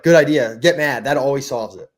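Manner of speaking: loud, high, mocking encouragement; sarcastic advice. Telling angry local players to get mad, loud sarcastic. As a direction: egg him on with heavy sarcasm. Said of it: "I was like".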